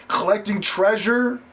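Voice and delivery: normal talking voice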